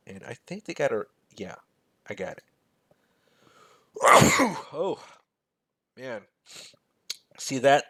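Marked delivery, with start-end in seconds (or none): 3.9-4.7 one sudden, loud, high sneeze; a real sneeze bursting out mid-sentence. Real, not acted. Sneezing at a scary red number on the spreadsheet. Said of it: "that red number made me sneeze".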